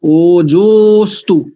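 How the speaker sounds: ओजोस्तु slow, fused as one word ojostu; ओजोस्तु as a single slow word; correct Sanskrit pronunciation. Shown as a demonstration. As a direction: stretch out ओजोस्तु, no a-sound between ojo and astu. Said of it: "ओजो अस्तु नहीं करेंगे".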